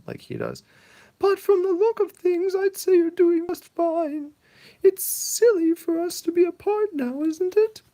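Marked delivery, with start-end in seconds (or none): 1.2-7.8 put-on quivery old mother. Mother comforts her son, high quavering old voice. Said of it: "she's got a quivery voice".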